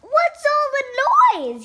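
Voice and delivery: high, cross little mouse; grumpy squeak, woken from sleep; mad voice. A narrator's reading. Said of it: "he said in a mad voice".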